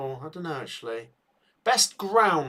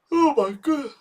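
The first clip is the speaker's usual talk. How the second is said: mid-yawn; 12 semitones higher, 5 dB louder than usual. Real, not acted. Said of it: "I'm not yawning".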